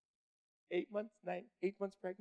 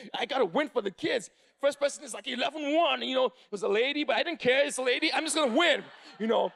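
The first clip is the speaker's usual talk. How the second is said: louder, higher, fired-up storytelling; pumped-up intensity; 6 semitones higher, 7 dB louder than usual. Acted. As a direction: build it like a man on a mission. Retelling his all-business tournament.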